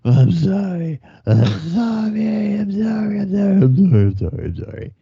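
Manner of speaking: hammed-up sobbing wail that fades out; mock sobbing. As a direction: imitate a blubbering drunk begging forgiveness. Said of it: "he's sobbing".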